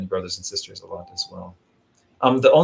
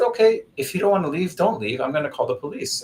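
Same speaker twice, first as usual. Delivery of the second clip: higher, breezy warning; mock calm threat; 6 semitones higher than usual. Warning the screaming beggar he will call police, breezy and higher. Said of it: "So I said".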